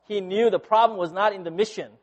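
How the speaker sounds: ordinary delivery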